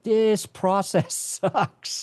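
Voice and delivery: slow, drawn-out mock whine with a laugh; whiny complaint. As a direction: drag it out like a fed-up beginner. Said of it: "they're like".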